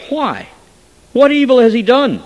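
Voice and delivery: high, loud protest; incredulous outcry. A narrator's reading. Governor protesting to the crowd, high and loud. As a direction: cry out in disbelief.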